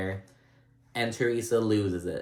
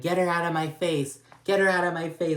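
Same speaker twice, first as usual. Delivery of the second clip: higher, mock yelled demand; 8 semitones higher, 3 dB louder than usual. Imitating Teresa yelling across the room, mock yell. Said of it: "she's like".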